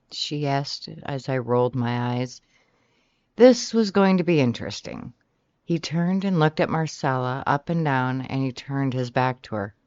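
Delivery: plain reading voice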